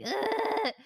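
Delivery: high, loud imitated bawl; hammed-up blubbering groan; mock ugly sob. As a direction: wail a fake ugly-cry sob. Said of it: "like, ugly crying".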